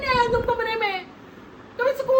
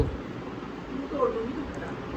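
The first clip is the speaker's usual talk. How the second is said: muttered threat; 11 semitones lower, 10 dB quieter, much slower than usual. Acted. Wife mutters threat under breath.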